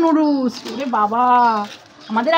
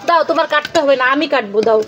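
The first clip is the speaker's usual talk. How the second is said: ordinary delivery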